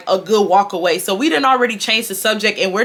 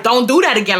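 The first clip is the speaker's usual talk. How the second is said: usual speaking voice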